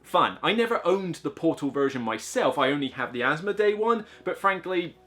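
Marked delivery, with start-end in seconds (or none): none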